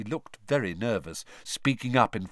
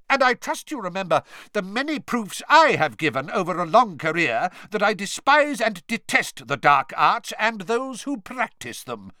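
loud, high furious outburst; shouted; 10 semitones higher, 6 dB louder than usual. A narrator's reading. Mister Crouch defending his record, shouting. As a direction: shout it, eyes bulging. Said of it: "Mister Crouch shouted".

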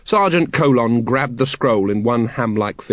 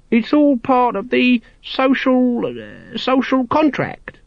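high monotone, stumbling over rote lines; wooden recitation; 13 semitones higher, slower than usual. A narrator's reading. Guard's assistant recites the official line, woodenly. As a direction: recite it stiffly on one high note. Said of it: "said his assistant woodenly".

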